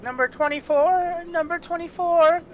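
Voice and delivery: harried baker shouting out ticket numbers; calling out numbers. Acted. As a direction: call the number over the crowd.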